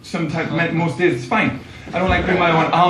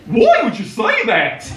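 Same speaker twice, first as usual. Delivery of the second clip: slow, higher Australian accent, badly faked; 4 semitones higher, slower than usual. Barista questioning a Geordie customer, bad Australian accent. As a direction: ham up an Australian accent, drawn out. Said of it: "I'm gonna definitely do the accent".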